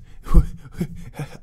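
loud breathy burst of laughter; breathless laugh. Real, not acted. Laughing at his own topic, loud and breathy.

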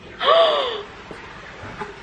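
long, high gasp of wonder; amazed gasp. Real, not acted. Vlogger impressed by her flatmate's light, amazed gasp. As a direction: gasp sharply in delighted surprise.

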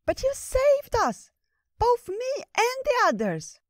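very high, loud squirrel cheering; excited squirrel. Acted. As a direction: squeal it like an eager little squirrel.

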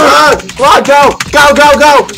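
loud, high yelling; real high-pitched urgent shouting; frantic urging. Real, not acted. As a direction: shout a player on through a chase. Cheering on during a game chase.

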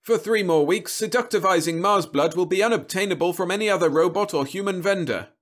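high, loud advert patter; insistent TV-pitchman delivery; persistent robot salesman. A narrator's reading. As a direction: keep pushing the sale. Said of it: "Roby persisted".

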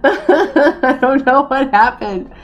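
slow, laughing sing-song I don't know what happened. Real, not acted. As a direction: laugh it out helplessly, drawn out. Repeats line laughing.

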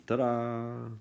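high, drawn-out little fanfare; playful ta-da. Real, not acted. Math teacher presenting the finished answer to the class, playful ta-da. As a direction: stretch it out high like a showman's reveal.

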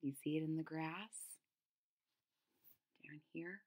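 put-on hushed question to kids; breathy secret whisper.

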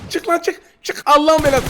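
high, loud angry calls; yelling him out. Real, not acted. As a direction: shout him out of cover. Yelling at hiding enemy. (saklanan rakibe öfkeyle bağırma)

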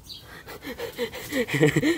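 high giggle burst; bursting out laughing. Real, not acted. Laughing at burning puffball.

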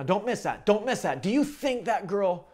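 ordinary delivery

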